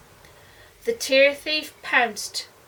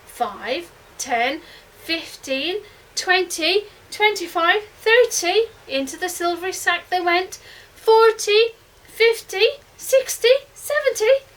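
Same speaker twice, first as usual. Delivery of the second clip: rising excitement; 6 semitones higher, 4 dB louder than usual. A narrator's reading. Counting tears faster and faster.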